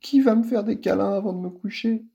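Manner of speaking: high lisping little voice, tearful; a small child asking, lisping and sad. Acted. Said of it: "zozote Sydney, un sanglot dans la voix".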